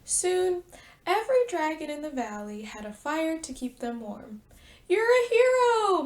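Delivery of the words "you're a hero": loud proud praise. Acted. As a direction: beam with pride. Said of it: "Mister Dragon said proudly".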